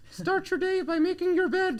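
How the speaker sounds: high, loud Jordan Peterson voice; Jordan Peterson impression. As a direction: do Jordan Peterson's Canadian accent. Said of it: "in his, uh, Canadian accent".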